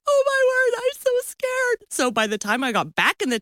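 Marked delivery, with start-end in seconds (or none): 0.1-1.8 very high, hammed-up scared squeal; imitated terror. Wife seeing bugs in bathroom, mock terror. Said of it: "I was so scared".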